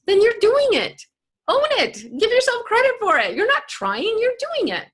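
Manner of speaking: fired-up coach; emphatic coaching. Real, not acted. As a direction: push them to own it. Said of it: "coaching the listener emphatically".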